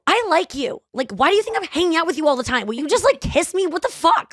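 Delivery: high, exasperated blurt; volcano outburst. Acted. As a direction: erupt with pent-up feelings, pitched high and urgent. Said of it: "in a fucking volcano".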